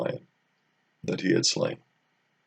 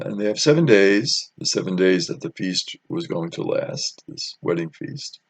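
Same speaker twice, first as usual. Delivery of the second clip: ordinary delivery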